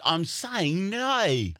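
high, drawn-out mock Australian; Australian accent. Australian insisting to a confused listener, high Australian accent. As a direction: insist on "no" as a stretched Australian "noy". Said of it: "Australian people, when they say no".

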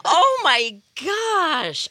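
slow, emotional, choking back tears; verklempt, fighting tears; choked up. Real, not acted. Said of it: "I'm a little verklempt right now".

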